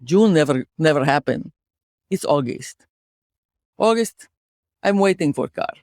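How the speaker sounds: put-on storyteller with a Russian accent. Host telling co-host about car accident, Russian accent. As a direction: tell a tale of woe in a Russian accent. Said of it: "could you do a Russian accent".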